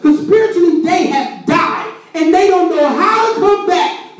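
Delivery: high-pitched, driving sermon cry; full-force preaching. Real, not acted. Preacher voices those dead in their situation, full-force preaching. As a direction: build to an impassioned preacher's peak.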